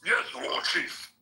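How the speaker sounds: slow, high orc voice; orc underling. Acted. Orc obeying the War Chief, slow high voice. Orc reply in the game banter.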